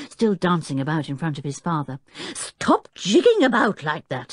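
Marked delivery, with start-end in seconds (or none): 2.3-4.3 higher-pitched, irritable order; exasperated father's telling-off; cross. A narrator's reading. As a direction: snap crossly at a fidgeting child. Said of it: "said the Professor crossly".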